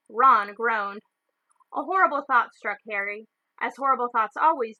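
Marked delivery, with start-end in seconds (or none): none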